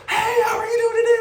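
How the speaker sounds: a squeaky, bouncing telemarketer pushing too hard; overly perky caller. Retold or imitated. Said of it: "you don't wanna be the person that's like".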